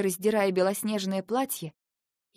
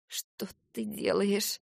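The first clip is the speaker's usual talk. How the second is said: slow, softer, trembling question; 3 dB quieter, much slower than usual. Acted. Wife asks husband undressing what he is doing, trembling. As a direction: ask fearfully, barely holding steady. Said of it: "дрожащим голосом спросила я".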